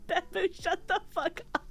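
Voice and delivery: playful laughing jab. Real, not acted. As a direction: crack up mid-insult. Laughing at chat.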